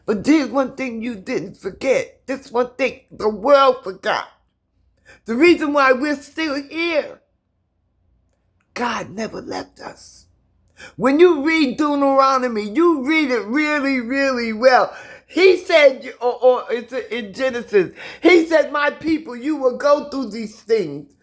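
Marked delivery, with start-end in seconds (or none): 2.2-7.2 high, loud preacher's build; preaching. Real, not acted. Preaching about God. 8.7-10.2 quiet. Real, not acted. Quiet line after loud build. 10.9-21.1 high, loud fervent sermon voice; preaching. Real, not acted. Preaching about scripture.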